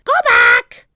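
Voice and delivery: very high, loud parrot screech; high loud squawk. A narrator's reading. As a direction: screech the warning. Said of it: "said Polly".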